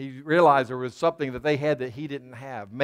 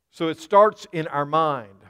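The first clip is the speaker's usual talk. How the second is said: louder, punching the word starts; emphatic declaration; 3 semitones higher, 7 dB louder than usual. Real, not acted. Preacher announcing his point to the church, emphatic. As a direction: proclaim it to the congregation.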